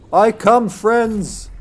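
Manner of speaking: high, loud mock-heroic call. Demon player announcing himself to his victims, calling out. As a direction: holler it to announce your arrival.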